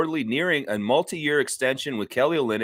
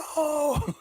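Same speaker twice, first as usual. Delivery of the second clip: laughing; 3 dB quieter than usual. Real, not acted.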